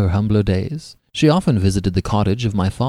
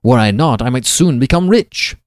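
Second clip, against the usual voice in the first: louder, indignant protest; heated retort; 3 semitones higher, 6 dB louder than usual. Acted. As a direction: snap back with wounded pride. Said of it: "I replied hastily".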